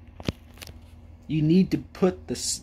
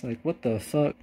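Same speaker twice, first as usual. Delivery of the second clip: ordinary talk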